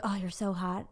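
very soft, low murmur; acted bedroom compliment, barely voiced. Acting a guy's murmured compliment.

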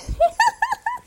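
a sudden squealing laugh high up; high giggling burst; high burst of laughter. Real, not acted. Laughs at own greeting.

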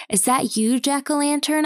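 called jovially. A narrator's reading. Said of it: "I called jovially".

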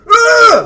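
sudden high startled cry; a sharp surprised yelp. Acted. Character struck by newspaper.

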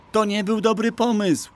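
very high, squealing character cry; frightened shriek. A narrator's reading. Pchełka clinging to a galloping horse, shrieking in alarm. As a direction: shriek it in a tiny panicked voice. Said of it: "wrzasnęła Pchełka".

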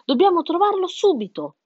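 high, loud and urgent; hen's exclamation. A narrator's reading. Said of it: "esclamò la gallina Penelope". (gallina che esclama con urgenza)

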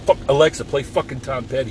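yelling at the smart speaker; angry outburst. Retold or imitated. Yelling at Alexa to play [name], angry shout. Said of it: "have an outburst like that".

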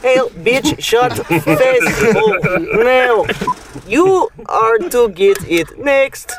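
put-on slow, robotic Russian accent. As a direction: stiff Russian robot, dragged slowly. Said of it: "I did a much better Russian robot".

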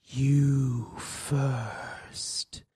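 low, soft, otherworldly voice; the dead husband's shadow answering Natalie, low and hollow; low ghostly shadow. A narrator's reading. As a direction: speak like a cold shadow spirit. The shadow replies.

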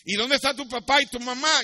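high, loud, insistent question; questioning king. Acted. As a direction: project the question to the child.

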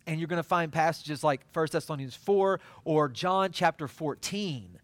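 ordinary talk, nothing marked

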